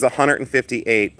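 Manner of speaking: usual speaking voice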